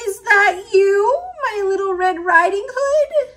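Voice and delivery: put-on high, crying old grandma voice; frail grandma. A narrator's reading. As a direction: quaver it like a sick old lady. Grandma answers the door.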